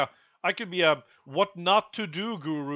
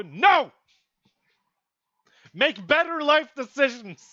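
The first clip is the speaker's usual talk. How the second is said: loud and higher, berating; scolding shout; 6 semitones higher, 5 dB louder than usual. Real, not acted. Vlogger scolding would-be followers, loud scolding shout. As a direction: yell the advice at them.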